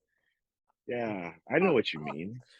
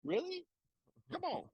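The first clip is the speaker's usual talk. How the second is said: mock disbelief; 10 semitones higher than usual. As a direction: scoff at the naive newbie. Mocking the overconfident beginner.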